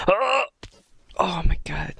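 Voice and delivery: sharp high startled oh; jolted by a painful sound; shocked gasp. Real, not acted. Gasping at painful-sounding death.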